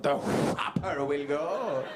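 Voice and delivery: slow, relishing the whopper will go; savoured repeat. Shown as a demonstration. Comedian repeats the car's line for fun, slow and relishing. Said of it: "say that again for my own amusement".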